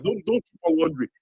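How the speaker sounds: usual speaking voice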